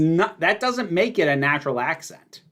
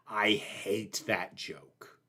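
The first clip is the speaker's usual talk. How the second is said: slow, low and much softer, dragging every word; low slow disgust; 6 semitones lower, 11 dB quieter, much slower than usual. Real, not acted. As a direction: grind it out in low, hushed contempt. Declaring dislike of a joke.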